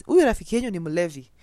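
loud teasing uncle. Retold or imitated. Uncle teasing her friends.

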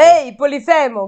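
loud call to Polifemo; shouting. A narrator's reading. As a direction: hail him across the water. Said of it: "gridò".

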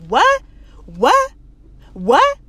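loud, rising, repeated whats; baffled. Retold or imitated. Man not understanding the guard, baffled. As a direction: punch each question upward, acting clueless.

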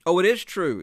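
high, loud, emphatic insistence; earnest exclamation. Acted. Man insists to the doubting traveler, earnest exclamation. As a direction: burst in to insist on the truth. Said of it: "exclaimed the other".

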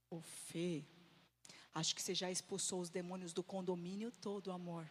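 lightly voiced husband, low and soft; low, understated deadpan remark; Marcos, imitated. Husband coming down to his shouting wife, low quiet voice. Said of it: "desce o Marcos em casa e fala assim".

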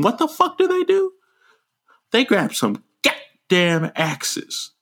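slow, incredulous outrage; outraged. Real, not acted. Telling Jordan about the axes, slow outrage. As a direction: drag out the disbelief.

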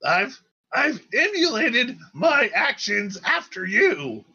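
high-pitched, excitable little leader; high, pinched-up character voice; Spit's character voice. Acted. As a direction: squeak it out high and eager. GM answers as Spit, the leader.

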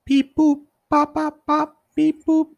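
high beep boop sounds like a rebooting machine; robot beeps. Pretend. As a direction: play a computer restarting with beeps.

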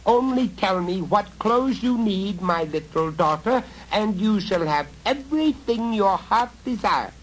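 high, loud wheedling goblin call; goblin man calling. A narrator's reading. Goblin father luring girl to marry his son, high coaxing call. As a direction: coax her out, sly and shrill.

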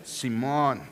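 Simón as see-MOHN, stress on the last syllable; Simón said the Mexican way. Shown as a demonstration. Pastor shows the congregation how to say Simón, low and easy. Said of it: "you have to say it like that".

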